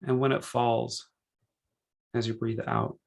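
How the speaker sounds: unhurried meditation guide, measured phrases with long pauses; calm, spacious relaxation-session delivery; guided meditation. Real, not acted.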